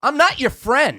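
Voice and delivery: high-pitched mock outrage; indignant caller. Customer to clerk who offers a callback, indignant. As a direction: push the voice high in comic exasperation. Acted quarrel with store clerk.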